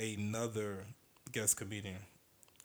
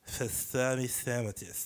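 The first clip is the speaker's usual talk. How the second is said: slow New York deli voice; slow, put-on New York accent; slower than usual. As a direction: stretch out "Pastrami sandwiches" like a New Yorker. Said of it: "that's what he sounds like".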